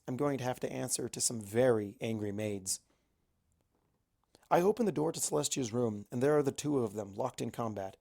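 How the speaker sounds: plain reading voice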